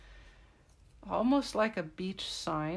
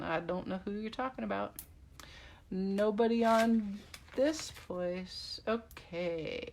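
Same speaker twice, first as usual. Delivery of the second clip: ordinary talk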